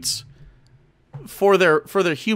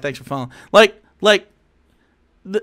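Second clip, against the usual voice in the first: ordinary talk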